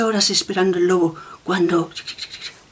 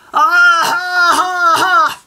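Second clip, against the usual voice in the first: loud high yelp of pain; bitten cry; 9 semitones higher, 5 dB louder, much slower than usual. Acted. Wolf crying out after biting the bear, loud yelp. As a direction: yelp like you've bitten something awful.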